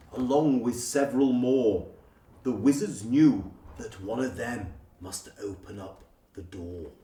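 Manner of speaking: plain reading voice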